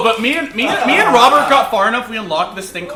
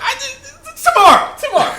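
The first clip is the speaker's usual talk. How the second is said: high laughing squeaky mock voice; mock lazy developer; 4 dB louder than usual. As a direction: parody the lazy devs, pitched way up. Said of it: "the next week goes".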